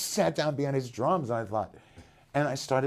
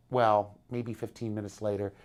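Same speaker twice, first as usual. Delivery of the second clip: usual speaking voice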